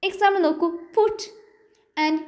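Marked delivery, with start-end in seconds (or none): 1.0-1.2 "put" with a stressed, aspirated p; "put", p released with a puff; stressed initial P. Shown as a demonstration. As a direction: hit the opening p of "put". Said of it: "p-നെ നമുക്ക് stress ആയിട്ട്".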